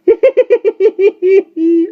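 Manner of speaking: put-on very high, loud shrieking old-woman laugh. A narrator's reading. Tiny old woman mocking Jane's remark, shrieking laugh. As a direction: throw the head back and shriek with laughter. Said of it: "shrieked with laughter".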